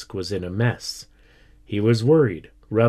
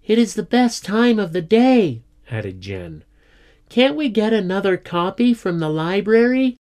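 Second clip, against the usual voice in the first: high, louder pupil's appeal; eager, high-pitched protest; complaining schoolchild; 10 semitones higher, 4 dB louder than usual.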